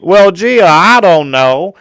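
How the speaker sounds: high, loud, slow Southern drawl; Southern girl. Retold or imitated. Mocking a country girl's coy reply in a Southern drawl. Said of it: "a Southern girl that's like".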